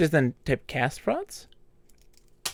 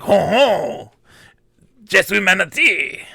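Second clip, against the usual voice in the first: mock French accent, a touch high and loud; hammed-up French accent; 4 semitones higher, 5 dB louder than usual. Player mocking how French sounds, hammed-up French accent. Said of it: "you're like".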